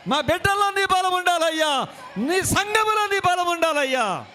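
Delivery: loud, high prayer cry building in pitch; fervent shouted prayer. Real, not acted. (గట్టిగా అరుస్తూ చేసే ఆవేశపూరిత ప్రార్థన)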